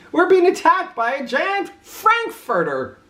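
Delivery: high frantic cat voice; panicked cats. A narrator's reading. Cats fleeing Oscar, high and frantic. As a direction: blurt it out in terror. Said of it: "cried the cats".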